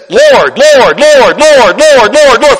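loud, high repeated Lord; fervent calling out. Retold or imitated. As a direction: cry out Lord with rising fervour. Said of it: "Well, David says".

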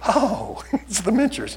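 high, singsong mocking "oh"; mock echo of wife. Preacher mimicking his wife's remark in the car, high mock echo. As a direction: echo her in a high, teasing voice. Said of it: "So I'm like".

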